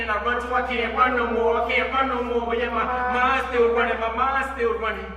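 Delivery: ordinary delivery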